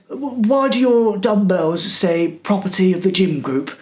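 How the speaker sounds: a hint of a suspicious old cleaner; mock prying question.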